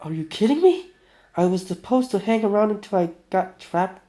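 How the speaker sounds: high-pitched, incredulous protest; indignant outburst. Acted. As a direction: protest in disbelief. First take of character's angry outburst.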